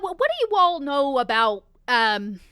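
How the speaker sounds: normal talking voice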